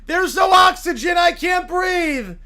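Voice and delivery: high, loud gasping panic; panicked shout. Acted. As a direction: scream that you can't breathe. Alien suffocating in costume.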